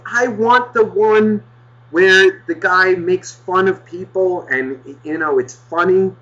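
high, drawn-out mock child demand; insistent kid. Kid nagging mom for a comic, high drawn-out voice. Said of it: "the kid starts getting louder".

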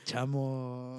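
friend impression with an accent; put-on voice of an accented buddy; Silvio. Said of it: "let's pretend Silvio confronted you".

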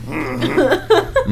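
grunted mm-hmm in character; Sling Blade guy. Acted. Comedian answering as the film character, soft grunted assent. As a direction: give a muted, comic grunt of assent. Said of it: "Sling Blade guy".

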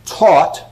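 "taught" hit firmly on a falling tone; stress on taught. Shown as a demonstration. Lecturer reading Shaw, stressing "taught". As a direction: punch the word "taught" as the list's key word. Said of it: "I put the emphasis on the word taught".

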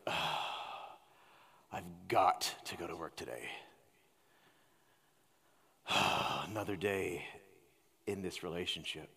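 a low, soft, defeated groan of a morning complaint; weary grumbling. Retold or imitated. Preacher mimicking a cynical believer dreading the day, weary grumbling. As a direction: drag the words out like the day is already lost. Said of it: "we greet the day and be like".

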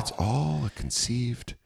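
soft, slow, unruffled delivery; calm radio host. Acted. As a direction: state it with hushed public-radio calm. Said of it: "I love how calm they are about".

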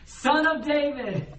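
long, high plea for mercy; crying out. Acted. Beggars crying to Jesus for mercy, crying out. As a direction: cry out high and drawn out. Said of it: "They both cried out".

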